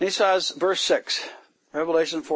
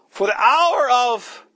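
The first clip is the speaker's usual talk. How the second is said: angel's proclamation; 8 semitones higher, 7 dB louder, slower than usual. Acted. Angel's loud message read.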